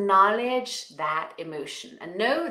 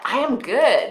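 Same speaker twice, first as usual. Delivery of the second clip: laughing affirmation; 3 semitones higher, 9 dB louder than usual. Shown as a demonstration. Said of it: "How about".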